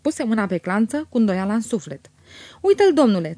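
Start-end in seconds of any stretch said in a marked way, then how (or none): 2.6-3.4 loud, raised-pitch proclamation; hearty, high call; trumpeting announcement. A narrator's reading. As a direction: trumpet it out to the room. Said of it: "trâmbiță Stănică".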